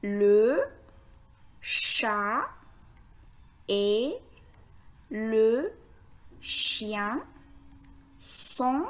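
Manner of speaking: one word at a time, long pauses between; word-by-word reading. Shown as a demonstration. Teacher reading the sentence for beginners, word by word. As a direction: spell out the sentence word by careful word. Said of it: "Je répète".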